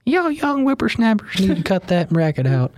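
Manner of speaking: put-on high, cranky old-timer scolding kids; old prospector. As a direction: grumble like a crotchety old prospector.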